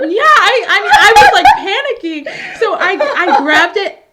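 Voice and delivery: high and loud, laughing through it. Real, not acted. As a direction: spill it out mid-laugh. Laughing recalling panic.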